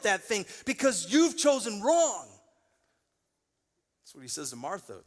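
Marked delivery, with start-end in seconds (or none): 0.0-2.3 rising from plain to a loud, higher, impassioned plea; God speaking. Retold or imitated. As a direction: build it up as God pleading with you. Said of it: "and he's going".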